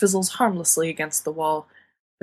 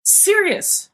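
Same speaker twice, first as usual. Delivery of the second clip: sharp, much higher call of the name; snapped name; 13 semitones higher, 4 dB louder than usual. A narrator's reading. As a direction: snap his name to cut him off. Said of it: "James snaps".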